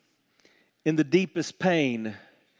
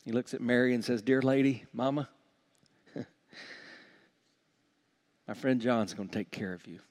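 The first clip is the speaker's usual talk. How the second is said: softer, lower, fond voice; tender quote; 4 semitones lower, 5 dB quieter, faster than usual. Retold or imitated. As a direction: speak to a friend and a mother gently. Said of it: "he says".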